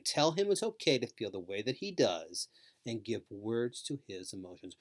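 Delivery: usual speaking voice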